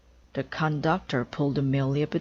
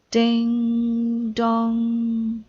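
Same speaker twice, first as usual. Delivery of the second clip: doorbell imitation; 7 semitones higher, 7 dB louder than usual. Amelia honks horns like doorbell.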